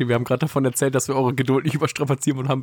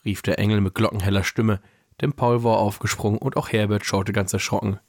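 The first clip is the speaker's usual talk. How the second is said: measured read-aloud storytelling voice; calm narrator, lower than in chat; story narration; 4 semitones lower than usual. A narrator's reading. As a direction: read the Christmas story aloud, pausing between sentences. Reads a Christmas story aloud.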